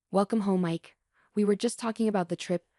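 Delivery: ordinary delivery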